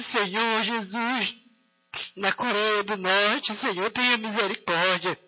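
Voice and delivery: ordinary talk, nothing marked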